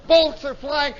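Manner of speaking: ordinary talk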